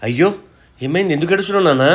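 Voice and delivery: high, alarmed questions; father alarmed to find his daughter crying; worried alarm. Acted. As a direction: rush to her, worried.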